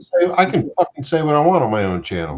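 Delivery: fast, high, loud defiant rant; indignant fast protest; defiant outburst. Real, not acted. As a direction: fire it back defiantly. Defiant outburst about swearing.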